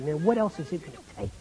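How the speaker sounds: very soft exasperation; restrained anger. Acted. Jesus out of patience with John, quietly angry. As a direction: drop low and quiet, quickly, fed up. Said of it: "I'm so angry with you".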